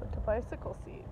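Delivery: fast whispered aside. Real, not acted. Owner whispering back to her companion about the bike seat. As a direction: hush it quickly under your breath. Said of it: "whispered reply".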